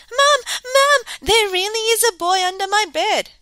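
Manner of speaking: high, loud frightened shouts; calling for Mum. A narrator's reading. Smook yells for mother.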